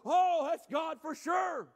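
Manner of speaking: high, loud, slow cry of certainty; amazed exclamation. Acted. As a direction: burst out in drawn-out amazement. Voicing amazed believer seeing change.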